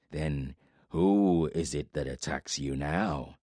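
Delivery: low, very slow, heavy voice of a giant warlord; menacing warlord from the shadows. Acted. Giant warlord speaks to Corbin from the shadows, low and slow. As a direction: let each word drop slow and low like a giant's threat. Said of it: "Ildair asked".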